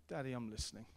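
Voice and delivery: put-on soft, childlike intimate prayer; gentle surrendered prayer; tender hushed prayer. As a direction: whisper it like a child to dad.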